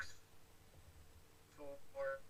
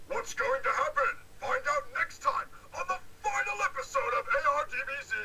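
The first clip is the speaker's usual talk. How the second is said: dramatic narrator; 10 semitones higher, 9 dB louder than usual. Pretend. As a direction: tease the next episode like a TV narrator. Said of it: "I really wanna be able to have the narrator say".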